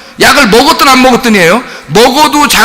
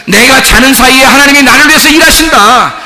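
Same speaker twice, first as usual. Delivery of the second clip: building to a louder, higher declaration; preaching proclamation; 5 semitones higher, 4 dB louder than usual. Real, not acted. Preacher declaring God's work to congregation, rising proclamation. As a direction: proclaim it with mounting conviction, pitch climbing.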